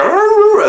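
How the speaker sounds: high, loud imitated wolf howl; wolf noise. Wolves warning off an intruder, imitated howl. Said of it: "the wolves go".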